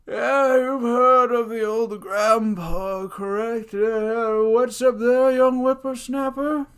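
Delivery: put-on high, loud creaky old man; old grandpa voice. Shown as a demonstration. Teacher demonstrating an old grandpa character voice. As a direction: cackle it out like a cranky grandpa. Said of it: "Think of how I made that voice".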